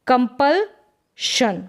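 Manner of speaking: कंपल्शन drawn out with a clear sh; slow clear sh. Shown as a demonstration. Teacher to class, slow model of the sh sound. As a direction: say कंपल्शन slowly, stressing the sh. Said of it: "कंपल्सन नहीं पढ़ेंगे".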